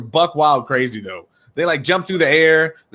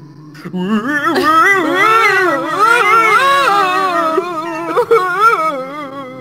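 high howling laugh; 13 semitones higher, 4 dB louder than usual. Acted. Gravestones make scary noises.

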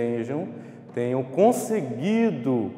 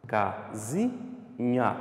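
"Casinha" softer, in three short-spaced syllables; sílaba por sílaba; 2 semitones lower, 6 dB quieter, much slower than usual. Shown as a demonstration. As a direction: recap "Casinha" slowly, syllables briefly separated. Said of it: "Você fala ela devagar, sílaba por sílaba". ("Casinha" mais baixo, ca, zi, nha em pausas curtas)

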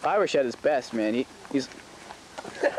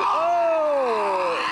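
excited shout; 5 semitones higher than usual. Real, not acted. Commentator reacts to a big hit.

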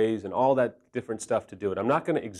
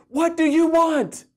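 loud, high, drawn-out imitation of a movie shout; hammed-up demand, stretched and pushed high; exasperated mock yell; 6 dB louder than usual. Man quoting The Notebook meme, mock exasperated yell.

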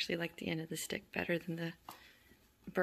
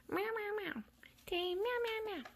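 very high little mewing; kitten meows; 13 semitones higher than usual. Real, not acted. Coaxing kittens to meow, high mewing. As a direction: mimic a kitten, high and squeaky.

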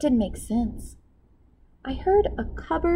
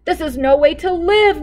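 exasperated cook; 8 semitones higher, 6 dB louder than usual. A narrator's reading. Cook despairs to Max.

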